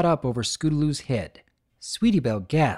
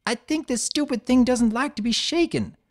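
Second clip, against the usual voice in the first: high voice through chattering teeth; 10 semitones higher than usual. Acted. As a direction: talk while shivering with fright. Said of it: "Scootaloo's teeth chattered".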